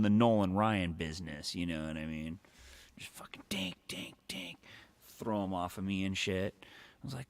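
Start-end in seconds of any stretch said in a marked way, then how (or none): 3.0-4.6 whispered punch noises. Retold or imitated.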